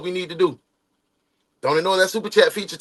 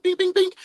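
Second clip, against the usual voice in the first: punch sound effects. Retold or imitated. Imitating punches.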